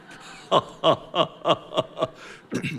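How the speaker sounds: long staccato laugh; drawn-out ha-ha laugh. Real, not acted. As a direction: laugh out in evenly spaced bursts. Laughs after quoting friend's lure.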